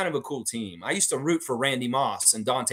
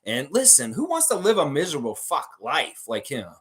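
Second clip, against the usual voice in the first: ordinary delivery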